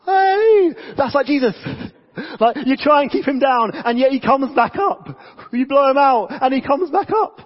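preacher thrilled the candle came back, excited; delighted shout. Real, not acted. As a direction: cheer like the trick just worked. Candle relights in demo.

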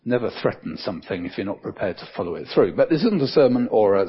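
ordinary talk

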